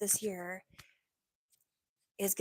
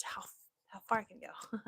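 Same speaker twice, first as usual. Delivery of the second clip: whisper. Acted. Trying ASMR whisper.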